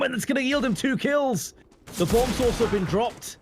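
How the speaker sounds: high, excited esports commentary; play-by-play. Acted. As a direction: build the hype through the kills.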